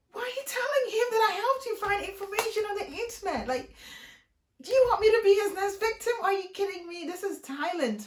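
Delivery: high, fast, rising outrage; alarmed high-pitched tirade; panicked outrage. Retold or imitated. Retold rant at friend, panicked.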